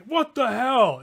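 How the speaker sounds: loud outraged shout; angry shout. Acted. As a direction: yell it in shock. GM voices angry shopkeeper.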